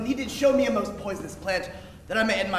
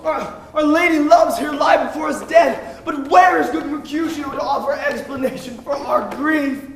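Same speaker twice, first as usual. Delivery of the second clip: melodramatic lament; 4 semitones higher, 6 dB louder than usual. Acted. Romeo laments over Juliet's body.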